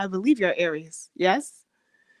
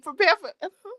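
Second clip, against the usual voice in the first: ordinary talk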